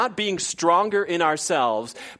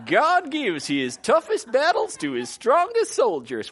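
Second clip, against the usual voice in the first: higher, slow, put-on pious preacher accent; hammed-up sanctimonious drawl; mock pious accent; 8 semitones higher than usual. Pastor repeats Hunter's line, hammed-up pious accent. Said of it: "with the accent".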